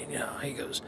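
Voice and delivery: usual speaking voice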